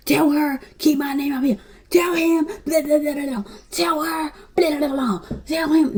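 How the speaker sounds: stuck in the middle passing messages, high and fed-up; mock go-between. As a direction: bounce between two callers, exasperated. Imitating both sides relaying.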